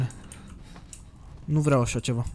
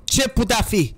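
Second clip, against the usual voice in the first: higher, raised exclaimed question; emphatic question; 5 semitones higher, 4 dB louder than usual. Real, not acted. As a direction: repeat it high and insistent. Same question, repeated louder.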